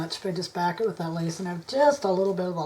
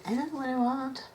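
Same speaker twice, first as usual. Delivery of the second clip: higher, put-on whine quoting herself; mock whiny indecision; 6 semitones higher, 4 dB quieter than usual. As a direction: mimic your own dithering, lightly. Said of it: "I'm sitting here spending my whole video going".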